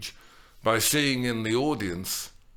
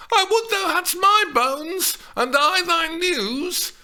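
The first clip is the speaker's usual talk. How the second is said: glib complaint; 7 dB louder than usual. A narrator's reading. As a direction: complain glibly as Juliet. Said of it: "Juliet complained glibly".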